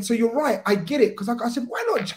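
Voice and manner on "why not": high-pitched squeaky protest; defiant question. Retold or imitated. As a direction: pitch it up like an indignant fan. Retold defiant reply to Dyer.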